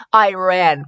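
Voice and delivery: ordinary delivery, nothing marked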